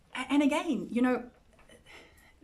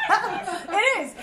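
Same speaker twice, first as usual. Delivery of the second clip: high, loud words through laughter; laughing; 9 semitones higher, 5 dB louder than usual. Real, not acted. Teacher confirming a perfect Sunday school answer, laughing. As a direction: say it while still cracking up.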